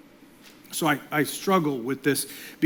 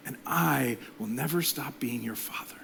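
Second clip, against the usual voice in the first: whispered tender promise; father whispering to his child; 4 semitones lower, 9 dB quieter than usual. Retold or imitated. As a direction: hush it into a whisper, like tucking a child in.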